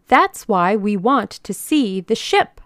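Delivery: loud, firm demand; insistent demand. A narrator's reading. Old lady demands the organizer show the ship, insistent. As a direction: press the point loudly.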